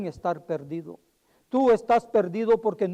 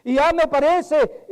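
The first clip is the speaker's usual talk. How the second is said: ordinary delivery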